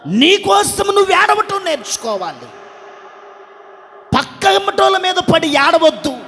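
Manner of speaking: high, loud exhortation to the congregation; full-force preaching. Real, not acted. (గొంతెత్తి గట్టిగా ప్రసంగం)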